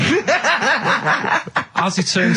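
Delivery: words broken by laughter; can't stop laughing while telling it; giggling mid-story; laughing through words. Real, not acted.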